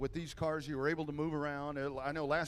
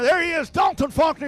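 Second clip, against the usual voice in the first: big shouted winner call; shouting; 12 semitones higher, 14 dB louder than usual. Real, not acted. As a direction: belt out the winner's name. Announcer hails the race winner.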